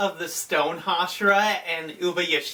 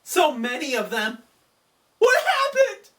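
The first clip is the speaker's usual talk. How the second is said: stunned outcry; 8 semitones higher than usual. Retold or imitated. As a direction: shout in disbelief at the blast. Tanjiro reacts to explosions.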